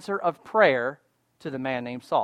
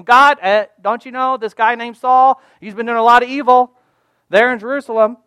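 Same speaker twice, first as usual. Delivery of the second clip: higher, louder, anxious pleading; protesting Ananias; 6 semitones higher, 8 dB louder than usual. Acted. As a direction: remind God urgently of the danger. Acted Ananias protesting to God.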